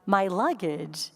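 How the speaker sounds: drawn-out realization; slow realization. Retold or imitated. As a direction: let it sink in slowly. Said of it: "Why didn't I understand?".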